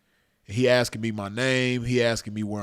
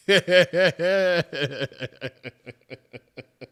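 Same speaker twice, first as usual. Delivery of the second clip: real, higher-pitched laughing fit; hard laughter; 5 semitones higher, 4 dB louder than usual. Real, not acted. Storyteller cracking up over the bystanders' reaction, hard laughter. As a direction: laugh hard, gradually running out.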